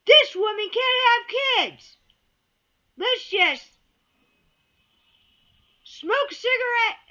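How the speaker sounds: high, loud jeering party voice; mock the bullies cackling at her; hammed-up taunting partier; mocking laughter. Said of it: "They go".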